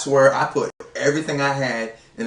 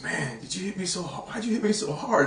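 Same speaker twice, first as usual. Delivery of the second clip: dazed complaint; 4 semitones higher, 9 dB quieter than usual. Retold or imitated. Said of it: "he goes".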